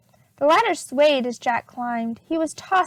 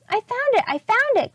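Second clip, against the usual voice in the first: high, thrilled exclamation; excited cry; 9 semitones higher than usual. A narrator's reading. Jack calling out to Annie about the treasure. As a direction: burst out with the discovery. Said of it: "cried Jack".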